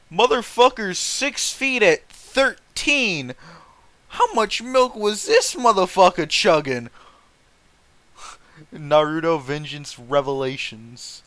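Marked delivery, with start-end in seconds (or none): 0.1-6.9 incredulous. Real, not acted. Streamer to chat about the tall kid, incredulous and loud. Reacting to an absurd detail.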